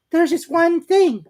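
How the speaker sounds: small fidgety piglet; Piglet's voice. A narrator's reading. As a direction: squeak it out nervously.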